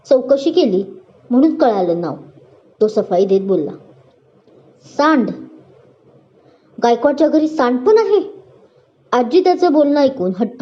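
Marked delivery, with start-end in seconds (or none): 5.0-8.2 grandma's mild surprised question, a bit higher; puzzled old woman asking. Acted. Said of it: "आश्चर्यचकित होत बोलते". (आश्चर्याने विचारणे)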